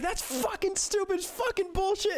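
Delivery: high, indignant coworker rant; mock outrage. Coworker venting to him about his firing, mock outraged. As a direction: play the furious coworker swearing. Angry coworker voiced in story.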